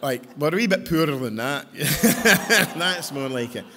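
joking through laughter; loud laughing quip. Real, not acted. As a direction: laugh along with the crowd.

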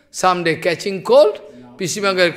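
usual speaking voice

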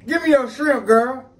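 high, loud teasing demand; playful demanding. Acted. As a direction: beg loudly, half laughing. Playfully demanding wife's shrimp.

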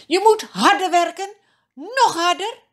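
loud, high shout of a cruel overseer; harsh command. A narrator's reading. Cruel overseers to the Israelite slaves, harsh high shout.

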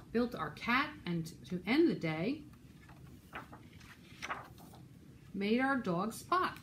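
put-on lively, rhythmic read-aloud for children; animated storytime reading; picture-book narration. A narrator's reading. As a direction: read a rhyming story to little listeners.

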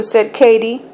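plain reading voice

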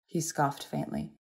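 ordinary delivery

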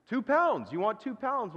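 high-pitched double-checking; lightly voiced astonished butcher; mild acted surprise. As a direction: repeat the order in mock amazement. Butcher amazed at the dog's order.

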